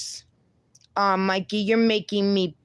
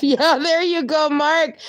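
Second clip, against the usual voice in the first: higher, louder laughing cheer; laughing approval; 7 semitones higher, 4 dB louder than usual. Real, not acted. Laughing approval of chat message.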